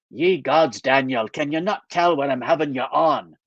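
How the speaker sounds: lightly Scottish, deadpan joke; a Scot having his friend on; mild Scottish brogue, dry humour; Scottish accent. Acted. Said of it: "he still has a Scottish accent".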